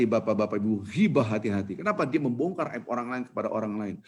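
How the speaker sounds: ordinary talk, nothing marked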